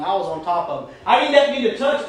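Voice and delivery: ordinary delivery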